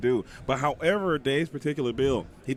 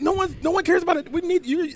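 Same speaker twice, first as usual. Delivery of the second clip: pleading; 12 semitones higher, 3 dB louder than usual. Real, not acted. Begging a teammate to perform.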